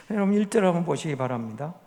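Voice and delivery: ordinary talk, nothing marked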